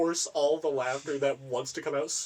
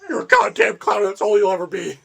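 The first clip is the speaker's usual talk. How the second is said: louder, higher furious clown-scene shout; aggressive yell; 6 semitones higher, 11 dB louder than usual. Retold or imitated. Actor yelling at Trevor in the sketch, loud and aggressive. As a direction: bellow it at Trevor like a vicious insult. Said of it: "So loud and so aggressive".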